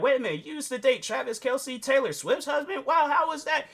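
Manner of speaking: nosy interviewer gasping at the news; talk-show host amazed at her dating past; mock astonishment. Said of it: "somebody gonna be all like".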